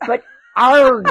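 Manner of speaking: gruff pirate growl. Pretend. Pirate day greeting.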